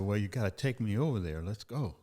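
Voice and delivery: usual speaking voice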